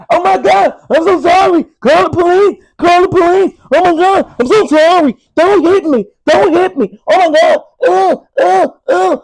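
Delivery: high, loud, whiny fake apology. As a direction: ham up a terrified crybaby begging not to be hit. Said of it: "Then they'll come and say".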